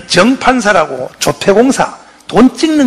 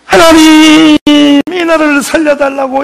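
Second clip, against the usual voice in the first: retold tearful prayer; 5 semitones higher than usual. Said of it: "이승만이가 울면서".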